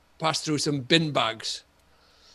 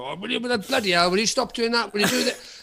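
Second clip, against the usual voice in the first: bullish nagging; 6 semitones higher than usual. Retold or imitated. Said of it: "sometimes you're quite bullish".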